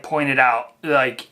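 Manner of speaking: ordinary talk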